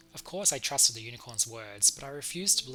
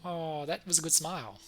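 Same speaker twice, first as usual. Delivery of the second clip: usual speaking voice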